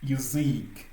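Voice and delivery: Yazik with a long, emphasized "ziik"; stressed final i. Shown as a demonstration. Teacher demonstrating the accent on i, Yazik drawn out at the end. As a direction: stretch the i in the last syllable of Yazik. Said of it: "l'accentuation est sur le i".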